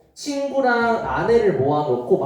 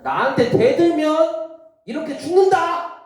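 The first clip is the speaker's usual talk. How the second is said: higher, louder villain threat; menacing threat; 12 semitones higher, 4 dB louder than usual. Acted. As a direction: snarl it like a vengeful villain. Haman vows revenge. (높고 크게 위협하는 악당 목소리)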